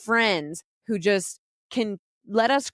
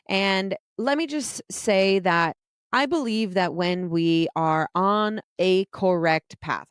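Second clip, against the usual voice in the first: ordinary talk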